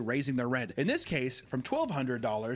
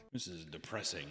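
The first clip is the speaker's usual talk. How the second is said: glum mutter; 6 semitones lower, 10 dB quieter than usual. Retold or imitated. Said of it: "and I was like".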